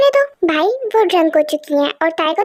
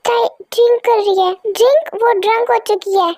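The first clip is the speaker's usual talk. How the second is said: ordinary talk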